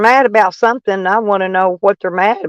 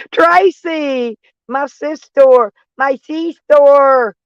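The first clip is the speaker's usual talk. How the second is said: excited greeting; 6 semitones higher, much slower than usual. Real, not acted. Greeting a viewer by name.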